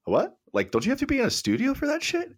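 high, disbelieving mock question; incredulous. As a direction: squeak out the disbelief. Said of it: "I was like".

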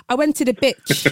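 "beach" said with a short clipped i, so it sounds like bitch; child's mispronounced "beach", short i; short-vowel beach. Retold or imitated. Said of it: "But I meant to say".

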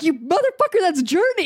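high, laughing indignation; retold exasperated outburst; mock outrage. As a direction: accuse him in amused disbelief.

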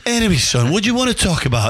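imitated manager's accent, pitched high; high-voiced impression of the old boss's accent; Sir Bobby. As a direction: move briskly on in the gaffer's high-pitched accent. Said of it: "I'll do his accent".

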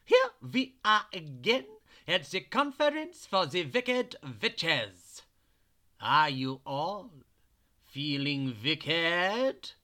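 a witch working up her crowd; wicked head witch. Acted. Head witch welcoming the wicked witches, gleeful witch voice.

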